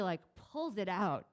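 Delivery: ordinary talk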